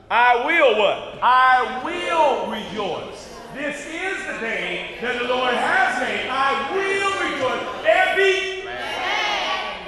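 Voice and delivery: loud, slow, drawn-out preacher's cry; preaching at full force. Acted. Preacher leading the church to rejoice, slow and loud. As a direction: stretch every word and shout it to the rafters.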